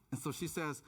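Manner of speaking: ordinary talk, nothing marked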